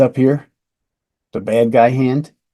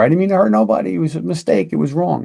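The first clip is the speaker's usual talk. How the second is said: mock suspect excuse; 6 semitones higher, faster than usual. Imagined suspect excusing himself.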